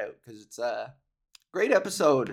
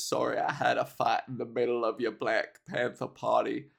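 Forrest Gump; 4 semitones lower, 3 dB quieter than usual. Retold or imitated. A hint of Forrest Gump apologizing at the party. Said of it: "the quote is".

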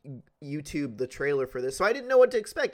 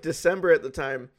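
ordinary talk

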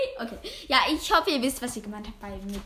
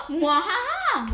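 slow, louder villain laugh; evil chuckle; 6 semitones higher, 6 dB louder, slower than usual. Acted. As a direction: mwahaha like a cartoon villain. Villain laugh at the Dumbledore pick.

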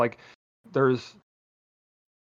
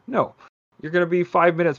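usual speaking voice

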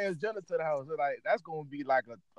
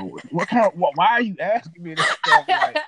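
louder, exasperated protest; flustered protest; 2 semitones higher, 8 dB louder than usual. Real, not acted. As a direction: push back loudly, flustered.

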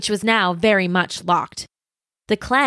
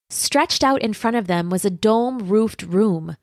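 plain reading voice

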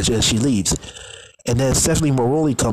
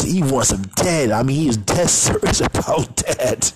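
ordinary talk

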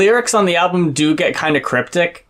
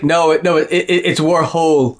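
put-on British accent. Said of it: "British people always gotta change the pronunciation".

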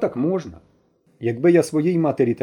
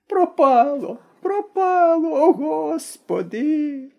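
very high, very slow despairing wail; despairing old woman; 13 semitones higher, much slower than usual. A narrator's reading. Granny despairs over her lost things, high and drawn out. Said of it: "розпачливо повторювала баба Мокрина".

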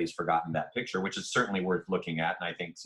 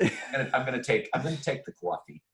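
put-on quick, higher New York accent, coffee as kwawfee; 6 semitones higher than usual. Playfully showing off his New York accent. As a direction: rattle it off like a New Yorker. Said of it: "Those were the two that I would sometimes do".

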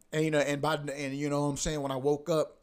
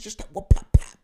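whispered smack sounds; 12 dB louder than usual. Retold or imitated. Imitating smacking the cat.

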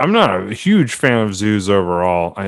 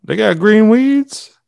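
surprised; 10 semitones higher, slower than usual. Real, not acted. Mock disbelief at review.

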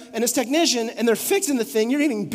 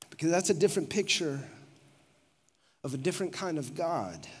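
soft sincere; 7 semitones lower, 10 dB quieter than usual. Real, not acted. Tender intimate aside to congregation.

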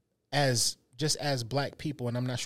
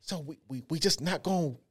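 hesitant mock-scared question, rising higher at the end; imitating her fear; 4 semitones higher than usual. Imitating scared partner doubting the walk-out, hesitant and higher. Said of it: "she's still scared".

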